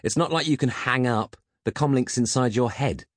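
high, nagging insistence; insistent. A narrator's reading. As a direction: keep pressing the silent butler. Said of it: "insisted Peg".

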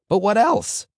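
rabbi's prompt leaping up high and loud; voice rising again. A narrator's reading. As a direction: shoot the voice up on "what else". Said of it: "His voice rose again".

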